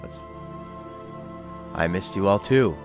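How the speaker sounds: normal talking voice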